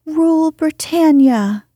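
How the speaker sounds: high, loud squeak; squeaky voice. A narrator's reading. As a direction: squeak it out like a small boy. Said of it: "squeaked Curly".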